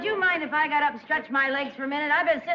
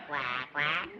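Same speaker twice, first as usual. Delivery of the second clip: quiet low quack, like a real duck; soft low duck quack; 13 semitones lower, 8 dB quieter than usual. Acted. Lucy quacks for the hunter, soft low duck call. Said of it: "Meet my duck call".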